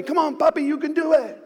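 a small cheer from the roadside; cheering kids. Retold or imitated. Kids cheering their dad on the run, high light cheer. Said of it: "they're encouraging me".

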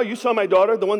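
ordinary talk, nothing marked

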